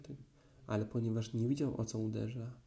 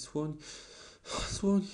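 breathless cry; 4 semitones higher than usual. Acted. Piglet, breathless, tells Christopher Robin about the elephant: high frightened cry. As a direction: blurt it out, still out of breath. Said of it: "bełkotał prosiaczek tak zdyszany".

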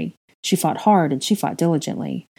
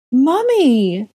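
put-on a higher, louder toddler's cry for his mum; child crying out; 8 semitones higher, 6 dB louder than usual. Toddler James crying out for his mother. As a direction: wail for a mother leaving. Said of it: "James cried".

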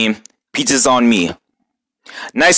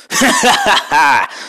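mocking laugh; 5 semitones higher than usual. Laugh after taunt.

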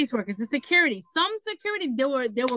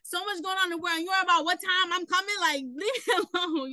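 retold annoyance, pitched up; mildly fed-up comeback in a higher voice; exasperated retort; 8 semitones higher than usual. Resident retells answering a nosy security guard, exasperated.

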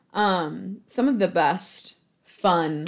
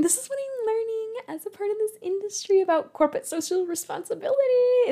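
high mock lament; 8 semitones higher than usual. Venting about corporate sponsors, in a high put-on voice. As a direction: pitch it way up and stretch the key words.

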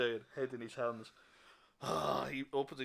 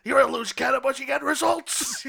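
loud, high stressed captain ranting; exasperated captain; 9 semitones higher, 13 dB louder than usual. Acted. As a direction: rant at the cop who costs you. Captain yells at cop.

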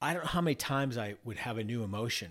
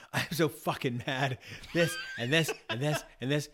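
voicing his rage; 5 semitones higher, 5 dB louder than usual. Rage at the inner table vents, mock furious and loud. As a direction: let the inner rage vent with mock fury. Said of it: "the rage could sit at the table and just be like".

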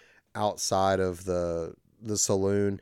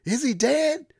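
put-on much higher, panicky kid voice; mock frantic yelling. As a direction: squeal it like a lost kid in a gunfight. Kid yelling during shootout, retold.

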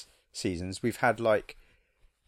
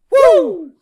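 very high, loud whooping woo; Tom Cruise woo; 17 dB louder than usual. Retold or imitated. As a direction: let out a wild celebratory whoop. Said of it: "Doing a Tom Cruise woo".

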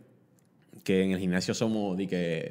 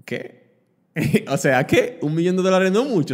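incredulous laugh; 8 semitones higher, 6 dB louder than usual. Retold or imitated. Disbelief at friend's question.

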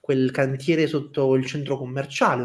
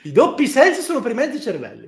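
high haughty quip; high character voice; 8 semitones higher, 3 dB louder, faster than usual. Acted. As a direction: sniff it out like a snob. In-character retort after laugh.

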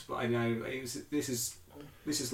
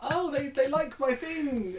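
fan voice; 4 dB louder, much slower than usual. Retold or imitated. Imitating a fan's reaction.

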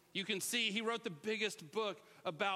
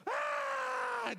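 high shouted cheer of surprise; surprised yell; 3 dB louder than usual. Retold or imitated. Family surprising mom at the river, a high shout.

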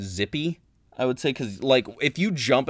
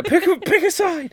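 very high comic heckle; jokey demand, pitched way up and louder; mock exasperated cry; 5 dB louder than usual. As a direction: squawk it in playful protest.

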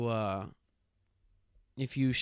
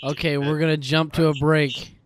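shouting over fire alarm; 3 semitones higher, 8 dB louder than usual. Real, not acted.

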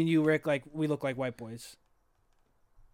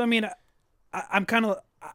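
ordinary talk, nothing marked

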